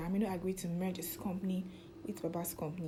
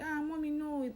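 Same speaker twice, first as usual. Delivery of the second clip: pleading son; 8 semitones higher than usual. Retold or imitated. Son stops mother leaving.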